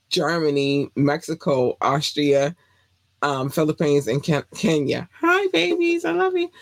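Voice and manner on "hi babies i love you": squeaky high greeting to fans; gushing high-pitched love; high squeal. Real, not acted.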